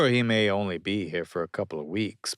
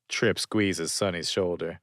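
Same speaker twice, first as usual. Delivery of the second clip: usual speaking voice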